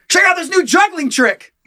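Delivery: high, eager show-off juggler voice. Pretend. Juggler boasting to the street, high and eager. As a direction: brag like a goofy street performer.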